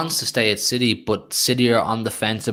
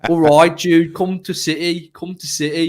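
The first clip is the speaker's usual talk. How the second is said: put-on second try at a Brummie accent, a bit higher; a more convincing Birmingham accent, louder at the start; better Birmingham accent; 5 semitones higher, 5 dB louder than usual. Second go at coaxing Jude in a Birmingham accent. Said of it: "your second go, you improved it".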